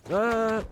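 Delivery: sudden high yelp; a quick, loud, startled cry. Real, not acted. Startled during jump in game.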